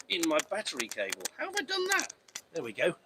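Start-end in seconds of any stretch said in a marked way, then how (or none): 1.4-2.1 sudden high squeak of surprise; surprised. Real, not acted. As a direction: caught off guard, voice jumping up. Trapped laces in the cable.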